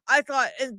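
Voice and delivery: ordinary talk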